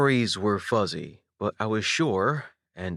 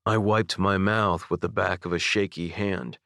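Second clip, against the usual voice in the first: plain reading voice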